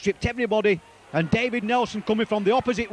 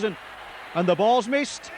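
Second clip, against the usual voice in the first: normal talking voice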